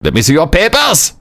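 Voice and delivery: mock German officer barking orders; mock German border guard demanding papers. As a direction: bark it like a wartime checkpoint guard.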